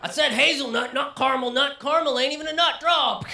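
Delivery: high, loud mock outrage over a latte order; hammed-up cowboy showdown over coffee; a customer squaring off at a barista, mock furious; mock coffee shop standoff. Acted latte shootout argument.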